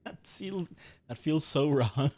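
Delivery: laughing it out, slow and loud; giggling guilty disbelief. Real, not acted. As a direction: laugh through the words in disbelief. Laughing at leaving teammates.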